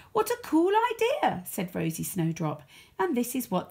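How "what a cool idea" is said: high, bright delight; excited. Acted. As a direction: squeal it out with glee. Rosie excited reply.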